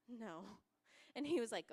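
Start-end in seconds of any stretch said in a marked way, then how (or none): 0.1-0.6 very soft retold no; tiny refusal. Scared child turning down dad's market invite, very soft. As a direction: barely voice it, a hushed refusal.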